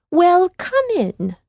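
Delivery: put-on high, slow sweet old woman; old woman inviting them in. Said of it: "replied the woman".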